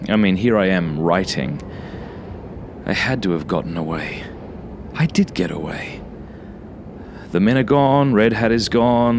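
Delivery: ordinary delivery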